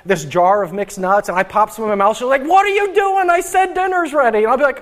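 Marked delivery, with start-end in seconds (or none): 2.4-4.4 scolding wife. Retold or imitated. Wife scolding husband eating early.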